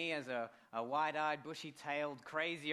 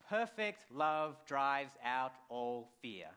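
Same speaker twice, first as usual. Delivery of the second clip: solemn scripture; 4 dB louder, much slower than usual. Acted.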